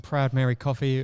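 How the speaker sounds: normal talking voice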